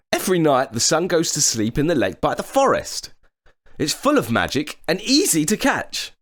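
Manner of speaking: put-on high boyish voice; boy's voice. A narrator's reading. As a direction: sound cheerful and clever as a boy. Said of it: "said Jack".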